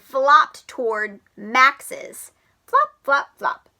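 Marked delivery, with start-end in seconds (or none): 2.7-3.7 high flop sounds. Acted. Flopping sound effect.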